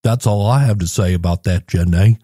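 a hint of Forrest Gump. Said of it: "sounds like Forrest Gump".

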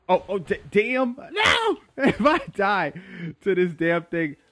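sudden high yell of alarm; panicked shout. Real, not acted. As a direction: scream it as the fight turns. Nearly dying in fight.

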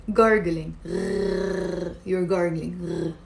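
"gargling" low, the gh rasped in the throat; gargling gh sound. Shown as a demonstration. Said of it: "the G H, remember".

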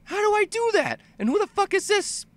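very high, excited squeal; amazed player. Acted. Player seeing the title-screen blaster, high-pitched amazement. Voices player awed by rescuer's arrival.

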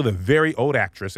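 normal talking voice

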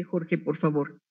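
ordinary delivery

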